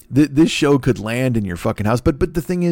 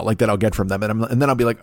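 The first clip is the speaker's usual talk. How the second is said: ordinary talk, nothing marked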